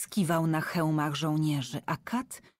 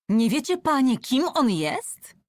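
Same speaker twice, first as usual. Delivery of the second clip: high, loud and agitated, slowing at the end; 8 semitones higher, 6 dB louder than usual. A narrator's reading. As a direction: demand it in alarm, voice raised high. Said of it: "zapytała ciągle bardzo wzburzona". (bardzo wzburzona, wysoko i głośno)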